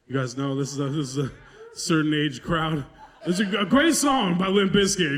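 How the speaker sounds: ordinary talk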